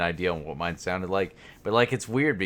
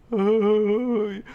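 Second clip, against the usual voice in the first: mock sweet insult; 6 dB louder, much slower than usual. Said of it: "And it's like".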